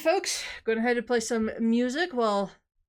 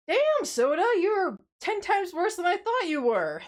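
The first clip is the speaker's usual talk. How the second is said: exasperated outburst; 9 semitones higher, 4 dB louder, slower than usual. Real, not acted. Scolding soda after workout.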